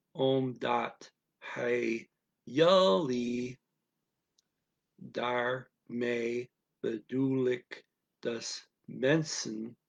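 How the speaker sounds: very slow dictation with gaps between syllables. Shown as a demonstration. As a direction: isolate every Dutch syllable, unhurried. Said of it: "read 'em one at a time".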